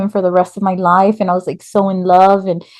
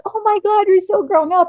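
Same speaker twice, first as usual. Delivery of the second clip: gushing teacher; 12 semitones higher than usual. Retold or imitated. Teacher greeting a former student's grown daughter, high gushing. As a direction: imitate an amazed, fond greeting.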